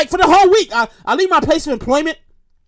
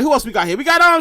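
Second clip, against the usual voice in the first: ordinary talk, nothing marked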